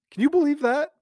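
mock disbelief. Incredulous repeat.

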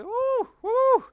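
imitating a scared little daughter, high and loud; scared little girl. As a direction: squeal like a toddler frightened of a dog. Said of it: "she was scared of the dogs. So she'd come and she'd be".

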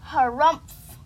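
very loud, acted grumble; huffy harrumph. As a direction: huff it out in a big burst. Grumbles after falling.

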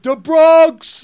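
loud high shout of the team name; shouted taunt. Retold or imitated. Stranger in the elevator taunting a Boston fan, shouting. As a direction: yell it back over your shoulder while walking away. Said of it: "he's like".

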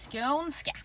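Skånska said with a lilting Skåne rise; Skånska in a light, joking Skåne accent; mock Skåne accent. Shown as a demonstration. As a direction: try out Skånska in a Scanian accent. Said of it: "jag ska inte prata så".

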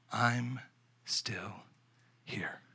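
each word separated and fading to near silence; three words slowly. Real, not acted. Preacher slowly giving the three words, fading away. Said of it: "With three words".

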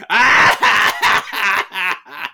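high, loud mock scream; hammed-up villain yell; villain outburst. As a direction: let out a long theatrical cry. Dominus's loud outburst, acted.

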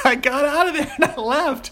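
high, giggly telling through laughter; laughing. Real, not acted. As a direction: laugh as you wrap the story up. Laughing through the story's end.

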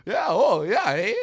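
loud, high mock Canadian; hammed-up Canadian accent, hollered. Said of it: "the most Canadian accent".

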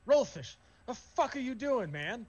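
high, incredulous scolding; mildly exasperated orc. Acted. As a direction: call out a comrade in mock disbelief.

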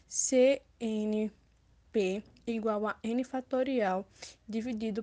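slow formula dictation; dictating. Shown as a demonstration. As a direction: spell out each symbol slowly. Reading a formula aloud.

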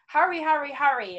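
high, loud hurry-up calls; hurried yelling. Retold or imitated. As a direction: rush the kids out loudly. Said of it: "screaming at my kids".

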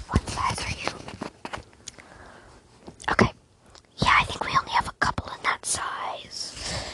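whispering shop assistant; hushed friendly store clerk; ASMR salesperson. Acted. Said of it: "salesperson trying to, like, sell you some clothing".

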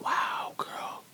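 awed whisper; impressed whisper to yourself. Retold or imitated. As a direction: breathe it out in a hushed whisper. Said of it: "you be like".

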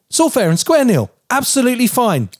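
high, sarcastic singsong; mock her breezy excuse; sarcastic mimicry. Husband mocking wife's excuse in high sarcasm.

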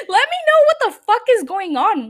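playful loud demand; loud sign-off. Real, not acted. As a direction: call it out to the listeners.

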